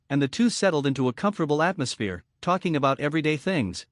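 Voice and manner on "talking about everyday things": ordinary delivery, nothing marked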